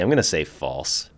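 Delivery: ordinary talk, nothing marked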